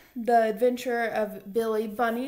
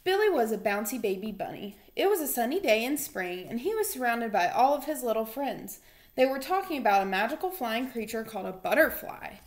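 lively storybook reading voice; reading to children; 4 dB louder than usual. Acted. Teacher reading a picture book aloud to her boys, animated. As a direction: read it out like story time for little kids.

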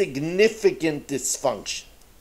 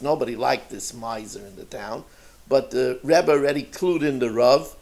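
ordinary talk, nothing marked

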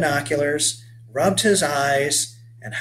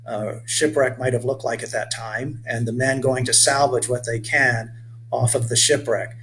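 usual speaking voice

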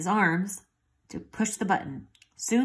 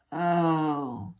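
plain reading voice

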